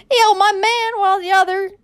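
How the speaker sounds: put-on high, loud frantic old woman's cry; frail grandmother screaming an accusation; old lady crying out. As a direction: shriek it out as a desperate old lady. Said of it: "cried the old lady".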